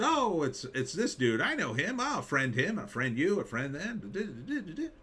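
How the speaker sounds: bouncy high sing-song, slowing into a laugh at the end; mock eager friending. Mocking old Facebook users adding everyone, giddy sing-song. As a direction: imitate naive early Facebook enthusiasm.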